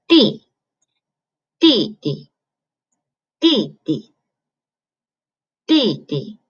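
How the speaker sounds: D D as "dì-di", first falling sharply, second light; fourth tone, light tone. Shown as a demonstration. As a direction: Mandarin "dì-di", stress on the falling first syllable. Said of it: "the tone has to go down".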